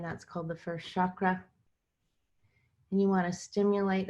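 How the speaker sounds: unhurried guided meditation with long pauses. Acted. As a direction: guide listeners into their bodies phrase by phrase, leaving room between.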